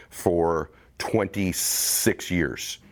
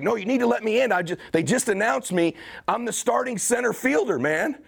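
desperate pleading; 13 semitones higher than usual. Retold or imitated. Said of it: "I'm like".

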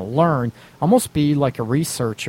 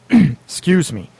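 slow, raised, cracking apology after clearing the throat; 4 semitones higher, 5 dB louder, slower than usual. Real, not acted. Host excusing his pubescent voice, cracking. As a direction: let the voice break like puberty. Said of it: "my voice going through puberty".

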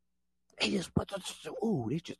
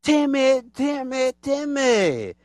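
higher, slower envious whining; mock jealous whine; 7 semitones higher, slower than usual. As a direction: sulk like a jealous rival. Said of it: "They just like".

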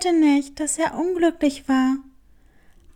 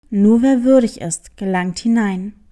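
low, louder start, dropping to a murmur; ominous cat; 7 semitones lower, 3 dB louder than usual. Acted. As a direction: pronounce it like a riddle. Cat's mysterious rule. (unheilvoller Kater, laut beginnend, dann leise)

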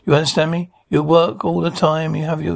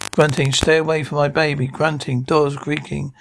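ordinary delivery

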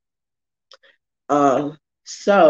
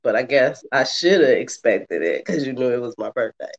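ordinary delivery

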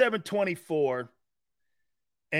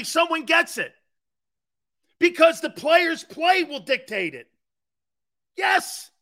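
loud, high, triumphant yeses; excited yelling; 9 semitones higher, 7 dB louder, slower than usual. Real, not acted. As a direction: shout it like you finally won the argument. Celebrating someone finally agreeing.

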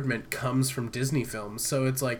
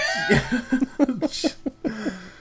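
laughing; 10 semitones higher than usual. Real, not acted. Laughing hard.

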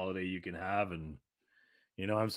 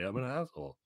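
ordinary talk, nothing marked

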